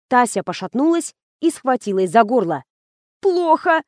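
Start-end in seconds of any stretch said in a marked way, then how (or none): 3.2-3.8 high strained gasp of a word; choked, much higher voice; barely forced out. A narrator's reading. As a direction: squeeze it out as if choking. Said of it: "выдавила она еле-еле".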